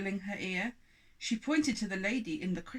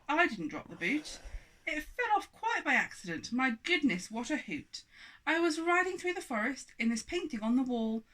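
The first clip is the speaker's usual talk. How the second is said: fussy lady; 6 semitones higher, 4 dB louder than usual. Acted. Lady protests.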